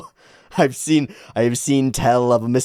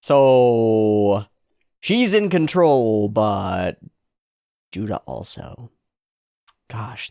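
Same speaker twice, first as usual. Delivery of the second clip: normal talking voice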